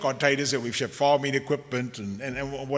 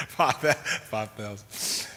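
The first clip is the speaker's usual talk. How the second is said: chuckling through the words; said through an amused laugh; 3 semitones lower, 3 dB quieter, much slower than usual. Real, not acted. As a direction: break into a laugh while naming the amount. Joking about needing another donation.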